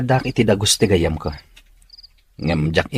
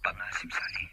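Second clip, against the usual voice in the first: hushed; 10 dB quieter than usual. Acted. Caller asking where they are, much softer. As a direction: keep it down so no one hears. Talking low on phone.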